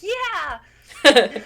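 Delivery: loud, high, drawn-out yeah; exasperated yell. Retold or imitated. Patient to doctor prodding a sore foot, exasperated yell. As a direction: yell it as if the answer is painfully obvious.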